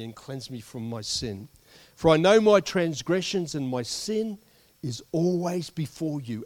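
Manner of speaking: earnest scripture read with rising intensity; prayerful psalm reading. Acted. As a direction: pray the psalm aloud to the congregation. Reads Psalm fifty-one aloud.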